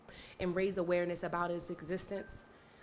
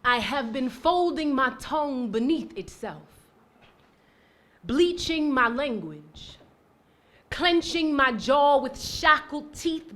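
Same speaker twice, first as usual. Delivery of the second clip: building, rhythmic slam delivery, punching words louder; spoken word performance; 9 semitones higher, 15 dB louder, slower than usual. Acted. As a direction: perform it like a slam poet claiming her voice.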